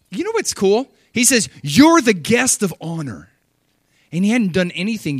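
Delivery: loud, high excited preaching; thrilled to share the point; preaching at full force. Real, not acted. Preacher exhorting.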